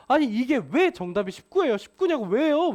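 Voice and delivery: loud, high-pitched mock protest; hammed-up student outburst, high and loud; imitating upset student. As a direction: act out a student kicking up a fuss. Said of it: "막 난리를 쳤었어요".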